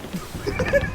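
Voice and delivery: airy wheezing laugh; breathy silent laugh. Real, not acted. Laughing at walls joke.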